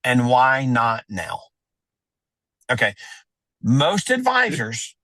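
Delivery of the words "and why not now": slow, deliberate, every word weighted; slow punchy tagline. Shown as a demonstration. Advisor delivering his signature tagline, slow and weighted. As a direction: land the closing tagline slowly. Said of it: "I love adding this tagline".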